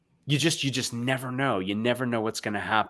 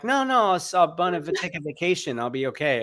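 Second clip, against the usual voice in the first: teasing impression; 7 semitones higher than usual. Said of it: "You're like".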